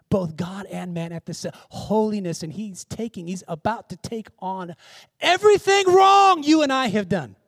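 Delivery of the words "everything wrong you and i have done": loud, high outburst from the pulpit; sudden high-pitched preacher's climax; impassioned preaching shout. Real, not acted. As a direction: cry it out at full force. Preacher climaxes on the burden of sin.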